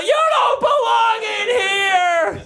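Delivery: high, slow hammed-up yell; mock yell. Owner scolding dog in bedroom, hammed-up slow yell. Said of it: "and I yell".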